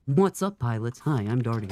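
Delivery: deep put-on man's voice; Dartigan. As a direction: greet chat as a mock-manly streamer. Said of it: "my old, my old tagline".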